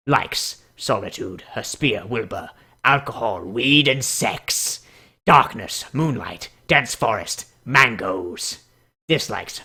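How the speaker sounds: low, grave dramatic read. Pretend. Streamer reading the bat pony bio dramatically. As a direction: read it like a brooding trailer. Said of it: "I'm doing the dramatic voice again".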